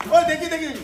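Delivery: high, loud burst of alarm; excited call. Real, not acted. Keeper alerting viewers to the lion's antics, high and excited. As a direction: grab the viewers' attention fast. Keeper sees lion break something.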